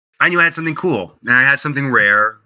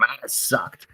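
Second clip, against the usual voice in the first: self-critical mutter; 11 dB quieter than usual. Retold or imitated. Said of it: "harshest critic on myself".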